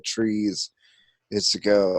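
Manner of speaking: normal talking voice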